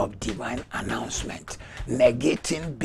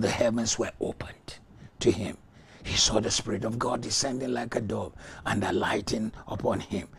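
ordinary talk, nothing marked

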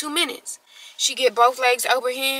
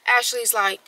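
ordinary talk, nothing marked

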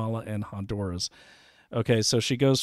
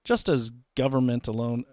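normal talking voice

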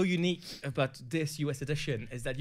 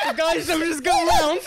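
loud, high words through laughter; laughing hard; 13 semitones higher, 11 dB louder than usual. Real, not acted. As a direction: barely get it out while cracking up. Speaking through hysterical laughter.

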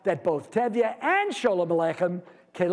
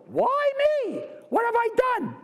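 much higher, pleading cry of Job; Job's lament. Retold or imitated. Lecturer voicing Job crying out to God, high pleading. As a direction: wail it up to heaven.

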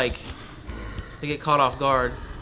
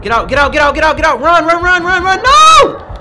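rising, louder panicked shouts; panicked yelling; 8 semitones higher, 7 dB louder than usual. Real, not acted. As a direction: panic more with each run. Panicked escape.

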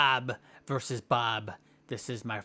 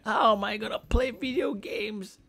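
high, hammed-up lament over lost arms; mock whining; 11 semitones higher than usual. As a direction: wail about the nubby arms.

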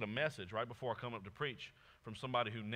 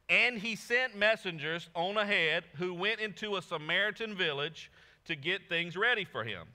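raised, louder reading voice; proclaiming the verse; scripture reading; 5 semitones higher, 5 dB louder than usual. Acted. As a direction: read it out to the congregation. Reads scripture aloud.

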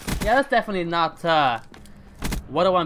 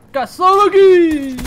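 high, loud, slow drawn-out cheer; thrilled shout; 11 semitones higher, 11 dB louder, much slower than usual. Real, not acted. Gamer cheering at a lucky kill, drawn out. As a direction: stretch it out, overjoyed.

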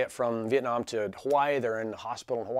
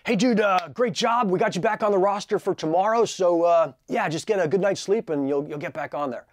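high, loud breezy boss; cheery boss voice; 7 semitones higher, 7 dB louder than usual. Retold or imitated. Boss greeting the pilot after landing, high and loud. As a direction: toss it off like it's nothing. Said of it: "He's like".

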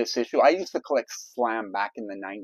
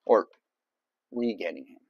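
ordinary delivery

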